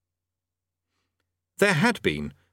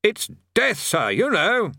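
higher, louder for a deaf man; raised voice; 10 semitones higher, 3 dB louder, slower than usual. A narrator's reading. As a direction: speak up clearly.

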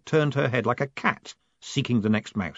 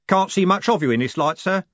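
higher, louder rough coachman; 9 semitones higher, 4 dB louder than usual. A narrator's reading. Jim answering Moist, a lightly voiced coachman. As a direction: play the cheery driver.

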